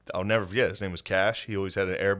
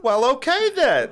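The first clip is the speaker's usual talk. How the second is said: high, loud, slow huff; mock an old lady squawking back; exaggerated high-pitched indignation; irate old woman; 7 dB louder, slower than usual. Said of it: "She was like".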